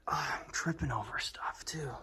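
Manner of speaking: breathy whisper while stumbling; whispered alarm. Real, not acted. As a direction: gasp it under your breath. Stumbling in dark water.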